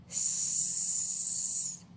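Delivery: long voiceless snake hiss on S; drawn-out s like a snake. Shown as a demonstration. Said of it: "is the snake sound".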